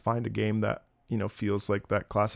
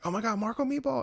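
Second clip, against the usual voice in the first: high, softer fan voice; imitated starstruck fan; 11 semitones higher, 6 dB quieter than usual. Fan spots the streamer at the restroom door, high starstruck voice. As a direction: recognize someone in a high little voice.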